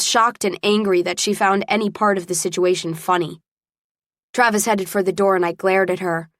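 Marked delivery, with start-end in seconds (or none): none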